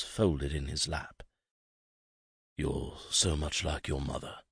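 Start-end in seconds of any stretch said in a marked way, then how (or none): 2.6-4.4 soft, low, murmured father; barely above a breath, lower voice. A narrator's reading. Father murmurs to his son, soft and low. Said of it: "he murmured".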